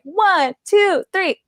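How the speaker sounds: high, chirpy countdown; mock countdown. Teen mocking the cheer she couldn't do, high and chirpy. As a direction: count it off like a peppy host. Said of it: "to be like".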